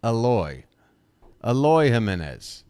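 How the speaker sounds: "Eloy" as uh-LOY, stressing the second syllable; careful corrected Eloy, uh-LOY; correct pronunciation. Shown as a demonstration. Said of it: "the second syllable. It's Eloy".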